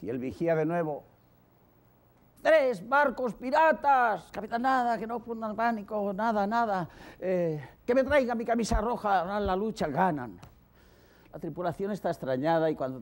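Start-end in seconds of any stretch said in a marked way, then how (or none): 2.4-4.3 high, loud, slow alarm; lookout's cry. Acted. Lookout shouts three ships. 4.3-10.4 commanding captain. Acted. Captain calms crew.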